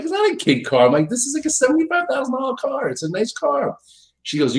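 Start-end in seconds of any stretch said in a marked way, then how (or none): 0.0-0.9 high, squeaky indignant protest; whiny high-pitched denial. Retold or imitated. Defending car to wife. 1.1-3.8 high pleading voice; mock-whiny high protest; pleading defense. Defending car to wife.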